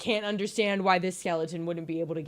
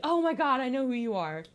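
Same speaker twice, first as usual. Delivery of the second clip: sudden realization; 6 semitones higher, 4 dB louder than usual. Retold or imitated. Hypnos realizing who Zagreus is.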